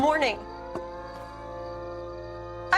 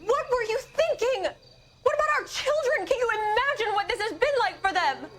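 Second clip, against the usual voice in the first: high-pitched, upset confrontation; anguished reproach; 7 semitones higher than usual. Acted. Wife confronting her husband about their children, anguished. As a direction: let hurt and anger push the pitch up.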